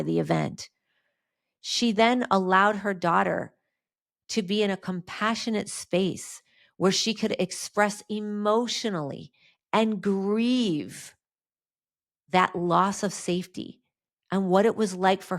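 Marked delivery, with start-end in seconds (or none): none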